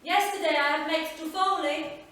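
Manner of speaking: high, loud Italian grandmother calling out; Italian nonna calling. Acted. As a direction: call to the child like a fond Italian nonna. Nonna tempting girl with treat.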